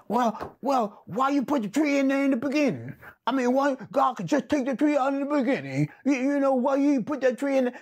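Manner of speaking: high, fast protesting questioner; mock objecting. Voices objector questioning God's decision.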